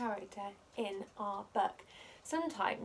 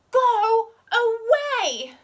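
much higher, very loud, slow drawn-out yell; furious yell; 12 semitones higher, 16 dB louder, much slower than usual. A narrator's reading. Pom Pom yelling at all his friends, furious yell. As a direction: scream it at everyone. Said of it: "yelled Pom Pom".

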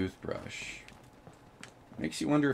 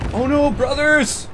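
dramatic wail; 10 dB louder, slower than usual. Acted. Dramatic cry in game.

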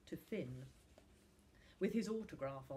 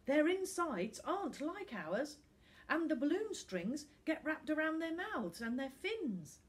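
put-on boy voice; 9 semitones higher, 6 dB louder than usual. A narrator's reading. Finn pleading.

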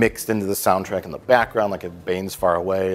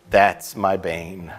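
slow proud declaration; much slower than usual. Retold or imitated. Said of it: "watched it and said".

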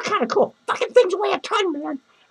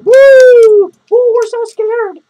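mock scared; 4 semitones higher, 13 dB louder, much slower than usual. Mocking fake fright at Freddy.

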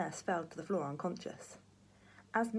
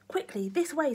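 high, loud urging; urgent; 9 semitones higher, 6 dB louder than usual. A narrator's reading. Grandpa hurries Jack away, loud and urgent. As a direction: hurry him along.

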